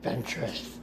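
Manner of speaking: soft unvoiced whisper of her name. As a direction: breathe her name menacingly. Dooku relishing her name.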